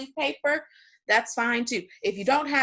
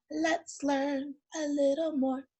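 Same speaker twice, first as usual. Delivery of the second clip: slow, stretched-out lead-in, pitched up; drawn-out segue; 6 semitones higher, 3 dB quieter, slower than usual. Real, not acted. Teacher leading the class into the next part, drawn out. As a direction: stretch each word out slowly and high.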